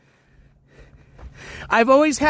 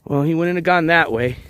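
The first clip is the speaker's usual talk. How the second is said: normal talking voice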